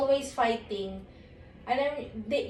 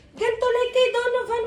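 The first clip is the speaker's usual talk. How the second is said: high, louder exasperated repeat; raised voice; 10 semitones higher, 4 dB louder than usual. Real, not acted. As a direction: raise it, losing patience.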